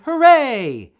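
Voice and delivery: high, loud joyful shout; crowd cheer. A narrator's reading. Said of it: "everyone shouted".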